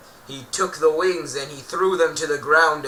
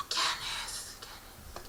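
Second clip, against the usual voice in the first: echoing whisper; 12 dB quieter, slower than usual. Acted. The Enderman calls Kenneth in an echoing whisper. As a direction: ghostly whisper calling a name. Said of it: "It echoed in Kenneth's head".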